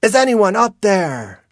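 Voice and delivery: loud, high call for help; high, ringing shout. A narrator's reading. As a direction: holler upward to whoever might be above. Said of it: "she calls out".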